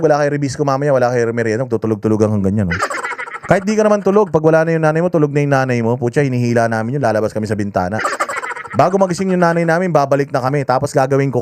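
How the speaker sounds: ordinary talk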